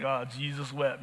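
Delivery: quiet aside. Retold or imitated. Preacher voicing amused kids muttering "Oh, Jesus wept" quietly. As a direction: murmur "Oh, Jesus wept" like a kid poking fun.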